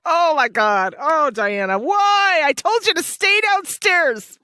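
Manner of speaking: high pleading "why?", then a frustrated telling-off; exasperated scolding. Pretend. Host scolds the heroine for leaving downstairs, high and exasperated. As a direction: scold her like a worried parent.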